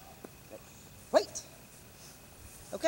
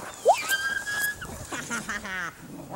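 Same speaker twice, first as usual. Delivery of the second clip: high squeaky laugh; 10 semitones higher, 8 dB quieter than usual. Real, not acted. Laughing after dog's run.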